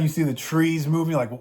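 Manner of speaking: usual speaking voice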